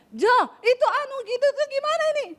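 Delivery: high-pitched hurried call to her husband; fast high talk. Retold or imitated. Wife calling her Solo husband, high and hurried. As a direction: rattle it off high, like an impatient wife. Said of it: "cepet, nadanya tinggi".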